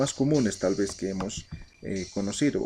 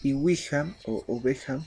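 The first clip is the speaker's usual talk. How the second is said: pronunciation drill; 2 semitones higher than usual. Shown as a demonstration. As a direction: call out every word clearly for the class to repeat. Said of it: "un repaso para la pronunciación". (repaso de pronunciación, palabra aymara más aguda y fuerte)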